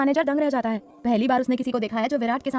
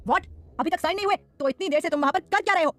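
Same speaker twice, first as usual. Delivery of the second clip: angry phone scolding, pitched higher; an impatient boss's angry outburst; roaring at him; 5 semitones higher than usual. A narrator's reading. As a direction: tear into the manager over the phone. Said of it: "Virat लगभग उस पर गरजते हुए बोला".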